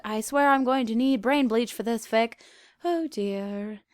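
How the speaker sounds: ordinary delivery, nothing marked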